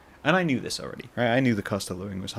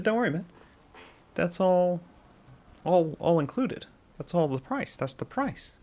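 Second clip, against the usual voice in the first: cashier reassuring; 9 semitones higher than usual. Retold or imitated. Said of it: "He said".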